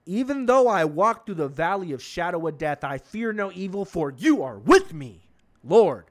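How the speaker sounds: booming scripture recital, hammering the final words; loud, fired-up dramatic reading; excited Bible reading. Acted. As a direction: belt out the verse with dramatic emphasis. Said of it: "I kinda got excited".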